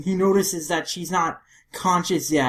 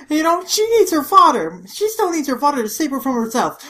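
raised, high-pitched insistence; ranting protective father; 10 semitones higher, 6 dB louder than usual. Acted. Father insisting his daughter still needs him, ranting. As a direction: plead the dad's case heatedly. Overprotective father's acted rant.

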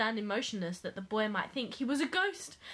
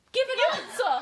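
high, loud hammed-up yell; mock crying out; 12 semitones higher, 9 dB louder than usual. Pestering people whether they liked our show, mock yell. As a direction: shout it as a comic demand. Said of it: "cried they".

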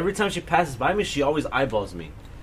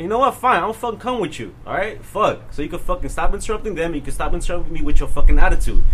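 higher, louder retold telling-off; fed-up retort; 3 semitones higher, 9 dB louder than usual. Student snapping at interrupting staffer, acted indignation. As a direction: build to a loud, indignant finish.

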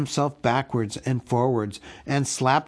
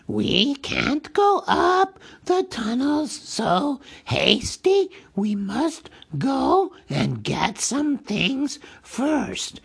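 high, slow hissing creature voice; Gollum hissing; 11 semitones higher, much slower than usual. A narrator's reading. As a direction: hiss it slyly at the hobbit. Gollum stalling.

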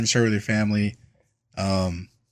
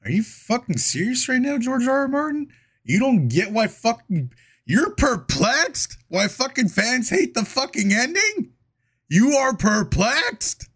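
outraged rant; 7 semitones higher than usual. Acted. Mock rant at George R R Martin.

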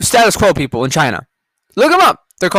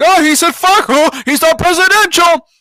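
high, whiny mock outrage; mocking media voice; 10 semitones higher, 4 dB louder than usual. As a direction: sneer it like a scandalised pundit. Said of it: "the media was like".

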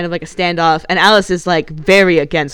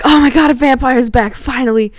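higher, louder gushing; mock thrilled Bella; 7 semitones higher, 4 dB louder than usual. Voicing Bella greeting Alice, mock gushing. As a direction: swoon over the vampire's return. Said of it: "but Bella's like".

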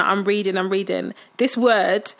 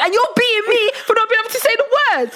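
much higher, laughing exclamation; 11 semitones higher than usual. Real, not acted. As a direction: laugh and squeal it out. Laughing outrage at the aunt.

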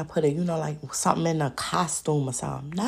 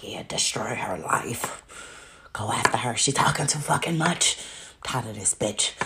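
whispered, sinister devil giving orders; scheming devil; 5 semitones lower than usual. Retold or imitated. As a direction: plot her ruin in a hushed devil voice. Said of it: "sitting in the background laughing".

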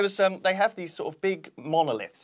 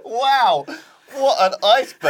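excited laughing; 11 semitones higher, 10 dB louder, slower than usual. Real, not acted. Shocked laughing at the lights.

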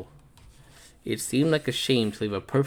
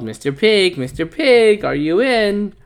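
calling out; 8 semitones higher, 5 dB louder than usual. Acted. Wolf calling at the door.

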